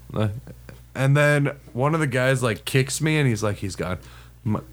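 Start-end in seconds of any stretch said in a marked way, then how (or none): 3.6-4.0 low, soft aside, imitating a friend; hushed tip-off. One of the guys tips off the man in hiding, hushed and low. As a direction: drop it down to a quiet all-clear.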